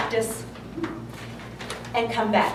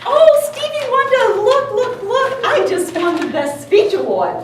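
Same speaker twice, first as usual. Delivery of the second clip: high, excited burst of news; excited bragging; 8 semitones higher, 4 dB louder than usual. Retold or imitated. Wife running home to husband with award, excited bragging.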